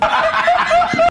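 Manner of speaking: a sudden high-pitched burst of laughter. Real, not acted. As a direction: cackle high up at the joke. Laughs at own joke. (tiz bir kahkaha)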